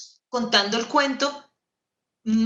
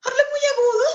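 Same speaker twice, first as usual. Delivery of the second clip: squeaky, much higher voice of a prim queen; much faster than usual. Shown as a demonstration. The storyteller shows how her prim queen talks, very high. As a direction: demonstrate the queen's voice, very high and strained. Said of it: "cuando la reina habla, habla muy agudo".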